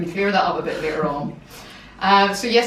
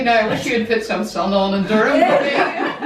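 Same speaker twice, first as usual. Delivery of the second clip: laughing; 4 semitones higher, 7 dB louder than usual. Real, not acted. Joking about weather, laughing.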